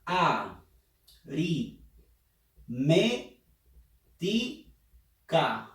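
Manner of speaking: aritmética dragged out very slowly; very slow syllabified. Shown as a demonstration. Teacher dragging aritmética out to find the stressed syllable. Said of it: "A ver, pronuncia".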